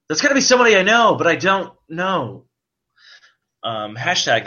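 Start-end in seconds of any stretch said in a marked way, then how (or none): 0.1-1.1 mock excited. Shown as a demonstration. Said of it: "That made absolutely so much sense". 1.2-2.4 higher, fast rush then a slow drawn-out "don't know"; mock excited. Shown as a demonstration. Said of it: "That made absolutely so much sense".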